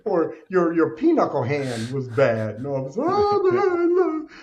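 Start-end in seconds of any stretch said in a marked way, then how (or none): 3.0-4.3 much higher, hammed-up whiny wail; mock crying whine. Mocking a man crying over a bad pinochle hand, high hammed-up whine. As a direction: ham up a grown man blubbering over nothing. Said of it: "if you crying on the basketball court".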